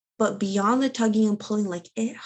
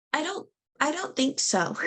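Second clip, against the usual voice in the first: higher, incredulous protest; stunned disbelief; 8 semitones higher than usual. Retold or imitated. Mother answering the nurse about a ten pound baby, higher disbelief. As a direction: refuse to believe the nurse.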